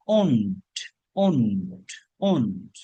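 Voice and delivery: won't said slowly, one clear syllable, falling; pronouncing won't. Shown as a demonstration. Teacher drills won't for students, slow and falling. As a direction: model won't for the class to repeat. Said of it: "উচ্চারণ হবে কি?".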